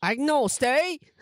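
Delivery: high, drawn-out mock British; British accent. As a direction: put on an exaggerated British accent, rising at the end. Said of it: "More British".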